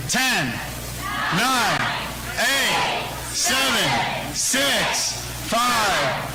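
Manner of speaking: high, chanting each number out; crowd countdown. Real, not acted. Leads crowd countdown on stage.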